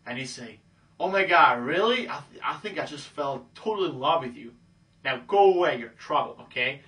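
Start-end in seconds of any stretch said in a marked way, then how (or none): 1.0-4.5 exaggerated sudden swoon; gushing love. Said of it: "This whole love thing". 5.0-6.4 playful about-face rejection; sudden dismissal. Acted. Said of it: "completely go a hundred eighty degrees".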